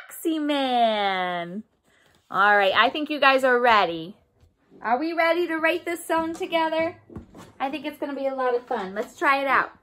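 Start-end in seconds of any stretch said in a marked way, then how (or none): none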